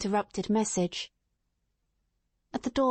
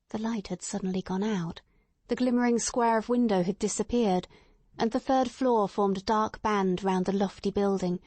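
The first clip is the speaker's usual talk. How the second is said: usual speaking voice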